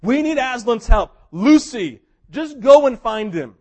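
loud, urgent call; urgent plea. Retold or imitated. Siblings begging Lucy for help, loud and urgent. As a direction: send her off with urgency. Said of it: "And they finally say".

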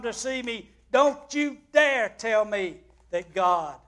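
put-on bitter patient raging at the young nurse; bitter tirade. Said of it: "ended his bitter tirade".

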